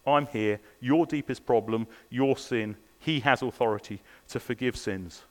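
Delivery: normal talking voice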